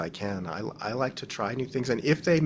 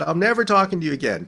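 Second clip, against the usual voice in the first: fast, louder mock-angry threat; joking mock threat; 5 semitones higher, 7 dB louder, faster than usual. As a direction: playfully pretend to cut him off. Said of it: "Just kidding".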